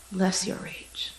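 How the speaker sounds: slow whispered blessing. Acted. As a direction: whisper it slowly.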